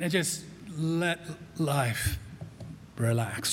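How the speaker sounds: drawn-out relaxed sigh of a line; letting go. Pretend. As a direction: let it melt away. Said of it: "acts out letting go".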